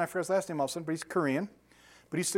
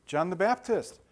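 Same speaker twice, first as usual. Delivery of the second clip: ordinary talk, nothing marked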